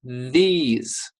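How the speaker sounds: "these" with tongue between the teeth; exaggerated th. Shown as a demonstration. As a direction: stretch the th in "these". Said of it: "Your tongue should come out of your mouth between your teeth".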